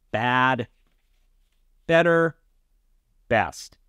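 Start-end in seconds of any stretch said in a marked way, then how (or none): none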